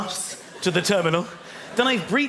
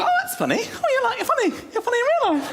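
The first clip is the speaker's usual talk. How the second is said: much higher, giddy fan voice; gushing fan. Retold or imitated. Fans meeting the comedian in real life, high gushing. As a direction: squeal how funny he is. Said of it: "they go".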